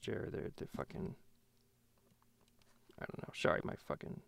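as if the microphone keeps failing; mic cutting out. Real, not acted. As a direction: voice dropping out on a bad mic. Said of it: "microphone was acting up".

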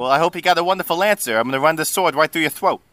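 fast New Jersey Italian threat; Giuseppe. Acted. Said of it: "Italian is gonna sound New Jersey".

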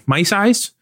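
"Mice eyes" asked high and rising; unsure question. Shown as a demonstration. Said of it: "which is the right way to do that".

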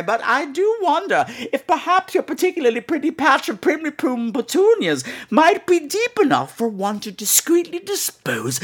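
prim British lady. Retold or imitated. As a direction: savour every sinister alliteration sweetly. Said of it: "the conversations are always like".

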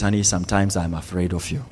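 very low, hushed retelling of a confession; quiet confession. Retold or imitated. Wife admitting fear to her husband, hushed and low. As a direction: confess it softly, barely lifting the voice.

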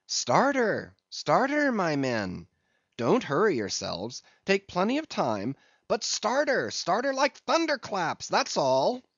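high-pitched urging call to a boat crew; high rallying cry, easing off between commands. A narrator's reading. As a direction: cheer the rowers on, leaping high on the commands. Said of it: "cried Stubb".

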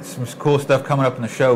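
ordinary talk, nothing marked

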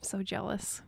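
barely audible breath of a whisper; hushed, voiceless whisper; whispered envy. Acted. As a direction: whisper it under your breath, awed and envious. Envious reaction to caller's accent.

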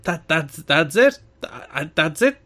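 normal talking voice

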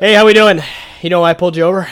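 a hint of Joe Swanson. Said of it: "a little Joe Swanson".

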